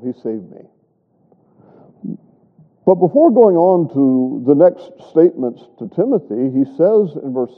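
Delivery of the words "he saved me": hushed, slow and soft; quiet. Retold or imitated. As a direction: breathe it out in awe. Paul's quiet personal wonder.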